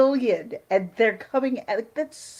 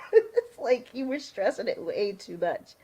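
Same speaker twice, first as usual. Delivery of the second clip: ordinary talk, nothing marked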